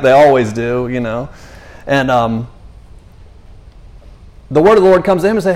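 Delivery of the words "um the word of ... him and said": ordinary delivery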